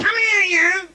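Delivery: high, loud, nasal shout of a nagging wife. Retold or imitated. Comic imitating his nagging wife calling him, high and nasal. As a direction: call him over like a shrill nagging wife. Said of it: "She talks through her nose".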